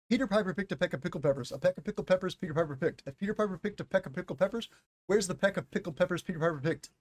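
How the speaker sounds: rapid tongue twister rattle; as fast as possible. Shown as a demonstration. Teacher racing through Peter Piper for viewers. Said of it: "I'm going to try to say this as fast as I can".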